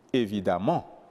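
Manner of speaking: "évidemment" said alone, weight on the final syllable; accent sur la dernière syllabe. Shown as a demonstration. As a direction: stress the last syllable of "évidemment". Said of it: "l'accent tombe à la dernière syllabe". (« évidemment », accent tonique final)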